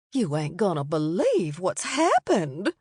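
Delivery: put-on an older lady pulling him into a hug; lightly voiced matron. A narrator's reading. Mary Elizabeth voiced by narrator.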